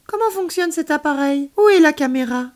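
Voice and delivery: high, eager questioning; intrigued. A narrator's reading. Child asking alien about the device, intrigued. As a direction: ask loudly, bursting with curiosity. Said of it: "lui demandai-je intriguée".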